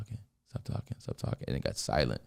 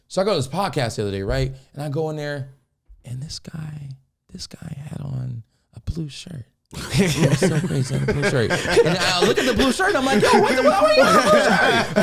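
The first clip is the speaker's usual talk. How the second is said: changing levels; 6 semitones higher than usual. Shown as a demonstration. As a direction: drop to a whisper, then blow up high and laughing. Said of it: "if I change the level".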